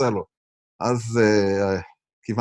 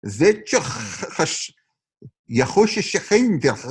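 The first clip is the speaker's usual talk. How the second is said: put-on Russian accent; 3 semitones higher, much slower than usual. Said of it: "לעשות לך חיקוי של רוסי".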